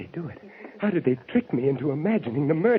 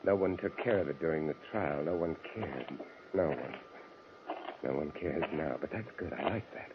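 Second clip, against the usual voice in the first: low, soft bitter inner voice; quiet brooding; 9 semitones lower, 11 dB quieter than usual. Acted. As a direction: muse alone, bitterly. Bitter inner monologue at home.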